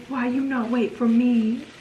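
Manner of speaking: put-on slow, babyish little kid voice; toddler voice. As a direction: talk like a pouting toddler. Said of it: "He's gonna say".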